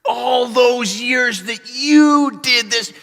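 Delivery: slow, seething build into a high shout; loud, drawn-out vengeful yelling; furious brothers. Acted. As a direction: explode with long-held resentment. Brothers turning furiously on Joseph.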